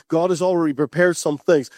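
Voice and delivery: normal talking voice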